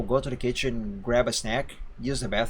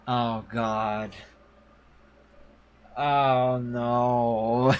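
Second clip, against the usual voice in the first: nervous laugh; much slower than usual. Real, not acted. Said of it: "that's a nervous laugh".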